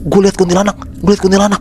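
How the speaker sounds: high-pitched, frightened blurting; acted panic. Faras tells friends he saw a ghost, acted panic. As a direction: blurt it out in mock terror. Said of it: "jawab kayak sambil panik gitu".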